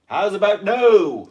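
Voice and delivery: loud, high, drawn-out refusal; Austin Powers quote. Acted. Quoting favourite film line, hammed-up loud refusal. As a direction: belt it out as a film catchphrase. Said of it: "we keep making Austin Powers references".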